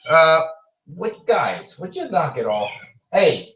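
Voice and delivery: exasperated call to squabbling animals, pitch jumping up; scolding pets. Real, not acted. Host to Sweet Pea and Biscotti tussling on air, exasperated scolding. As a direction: break off to tell the pets off.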